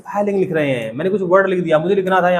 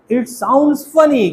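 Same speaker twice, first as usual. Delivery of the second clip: with a smile; 7 semitones higher, much slower than usual. Shown as a demonstration. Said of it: "थोड़ा मुस्कुराना पड़ेगा".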